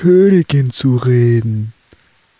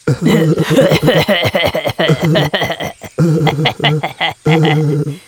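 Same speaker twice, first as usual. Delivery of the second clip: long amused laugh. Real, not acted. Laughing at the song.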